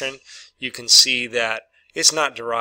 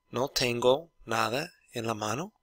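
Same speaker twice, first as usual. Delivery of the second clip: question to students; 7 dB quieter than usual. Retold or imitated. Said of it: "I would say that as a question".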